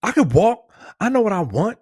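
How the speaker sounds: high huffy indignant rant; mock outrage at the helpful clerk. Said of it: "It's like".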